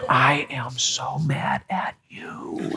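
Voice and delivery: slow, drawn-out mad whisper; whispered anger. Shown as a demonstration. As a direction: whisper the fight very slowly. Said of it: "Whisper all your disagreements".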